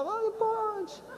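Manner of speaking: distant, faint, slow call; far-off echo. Retold or imitated. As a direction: heard from far away. Last man hears it far off. (eco distante)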